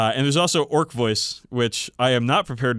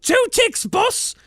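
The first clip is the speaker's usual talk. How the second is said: very high, squeaky groveling grot; groveling goblin; 6 dB louder, slower than usual. Acted. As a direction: cringe and grovel in a tiny voice. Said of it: "groveled the Grot".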